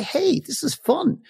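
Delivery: light, breezy and high; carefree inner voice, high and quick; playful. Shown as a demonstration. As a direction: say it like it's fun. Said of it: "feel it and say".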